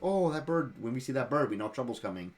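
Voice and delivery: a touch of an anxious villager; spooked townspeople. Retold or imitated. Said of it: "all the townspeople are like".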